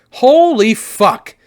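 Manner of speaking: high, loud, dragged out; loud slow groan. Real, not acted. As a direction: groan it out in shock. Reacting to eye poke.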